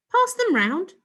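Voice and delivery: high, loud bossy command; bossy order. A narrator's reading. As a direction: order them about like a little boss. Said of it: "she ordered".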